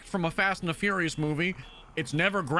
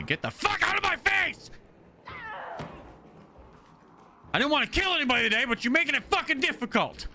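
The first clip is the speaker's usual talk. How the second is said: much higher, fast angry yell; furious shout; 12 semitones higher, faster than usual. Real, not acted. Streamer raging at an enemy who ignored his warning, furious yell. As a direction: explode at the player who didn't listen.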